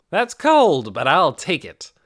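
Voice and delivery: loud, high laughing-it-off reply; loud joking comeback. Acted. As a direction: shrug off the insult loudly. Lacroute jokes off the insult.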